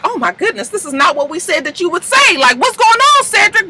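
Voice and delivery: high, rising panicked disbelief; alarmed disbelief. Retold or imitated. Eva stunned as the rapper goes off script, high and panicked. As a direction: voice jumps up in shock and dismay. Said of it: "Eva's looking like".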